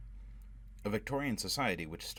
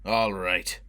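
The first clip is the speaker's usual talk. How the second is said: a sharp, loud burst; angry outburst; 8 dB louder than usual. A narrator's reading. Foyle bursting out angrily at Robin. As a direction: snap it out in anger. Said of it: "Foyle burst out angrily".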